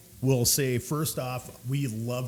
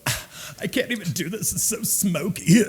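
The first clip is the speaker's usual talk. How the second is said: whiny complainer; 7 semitones higher than usual. Retold or imitated. Newcomer one drink into a cigar bar, mock whining. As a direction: imitate a customer overcome by smoke.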